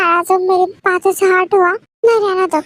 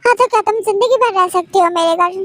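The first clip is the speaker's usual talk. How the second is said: ordinary talk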